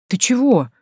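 high, startled question; lightly acted surprise; surprised. A narrator's reading. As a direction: turn round puzzled, pitch jumping up. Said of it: "Танька удивлённо оглянулась".

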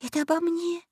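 tiny, high hushed voice; soft little stage whisper. A narrator's reading. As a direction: confide it softly in a high voice. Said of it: "прошептала Заори Алисе".

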